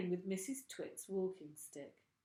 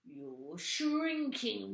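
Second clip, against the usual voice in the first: very slow, louder gloating taunt; drawn-out taunt; 6 semitones higher, 6 dB louder, much slower than usual. A narrator's reading. Mr Twit taunting his wife, slow and gloating. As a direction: stretch it out to scare her.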